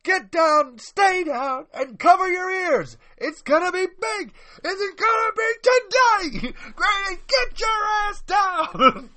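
loud, high commanding yell, slowing down; bracing for a crash. Acted. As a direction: bark orders before the blast hits. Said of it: "I gotta do these lines real quick".

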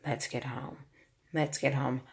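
soft, low repeated mantra; quiet mantra. Retold or imitated. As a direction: murmur it to yourself. Tearful mantra while driving.

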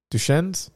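"Duchesne's" as "doo-SHENZ", rising; unsure pronunciation. Real, not acted. Host still guessing the place name, questioning "doo-SHENZ". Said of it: "am I saying that right?".

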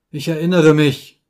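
pained groan of recollection. A narrator's reading. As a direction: groan it out like a sick man. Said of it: "stöhnte Holmes".

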